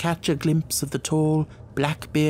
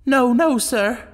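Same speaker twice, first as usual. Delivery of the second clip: much higher, loud anxious woman; woman's voice; 11 semitones higher, 4 dB louder, slower than usual. A narrator's reading. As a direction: cry out in a woman's plea. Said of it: "It was a woman's voice".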